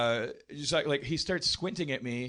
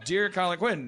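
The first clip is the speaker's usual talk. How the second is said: lightly voiced aging comic dictating a letter; a hint of old Colin Quinn; old Colin Quinn impression; 2 semitones higher than usual. Old Colin Quinn writing an angry fan letter, mock grumble. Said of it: "now Colin Quinn's like".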